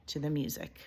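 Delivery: whisper. A narrator's reading. Narrator hushes phrase.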